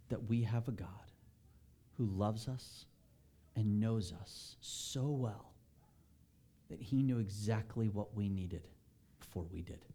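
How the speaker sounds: gentle sermon. Acted. Pastor gently telling the congregation God knows their needs. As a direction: bring the room down into quiet conviction.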